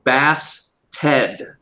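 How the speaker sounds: bastard as two words, Bass Ted; split syllables. Shown as a demonstration. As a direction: break it into Bass, pause, Ted. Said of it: "I imagine it's like Bass Ted".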